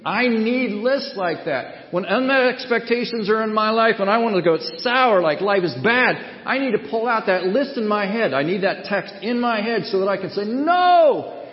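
high, loud, impassioned sermon delivery; forceful preaching. Real, not acted. Preacher refusing to go sour over unmet expectations, forceful preaching. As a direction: preach it hard, peaking on a defiant cry.

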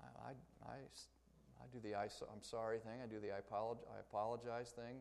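soft, hesitant inner voice; inner thought. Retold or imitated. A listener quietly realizing they say this too. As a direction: mull it over quietly, a bit sheepish. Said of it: "right now you're thinking".

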